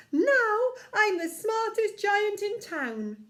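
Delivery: loud, high, slow boast; proud giant. Acted. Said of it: "he said proudly".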